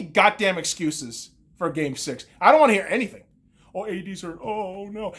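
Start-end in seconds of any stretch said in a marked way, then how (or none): 3.7-5.1 soft, whiny mock of excuse-makers. Fan mocking Lakers excuses, soft and whiny. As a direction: sneer the excuse quietly. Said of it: "A D's always fucking hurt".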